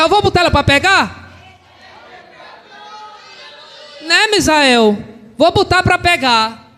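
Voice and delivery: high, forceful pulpit proclamation; triumphant preaching. Real, not acted. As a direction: preach the victory at full force. Preaches triumphant climax at full force.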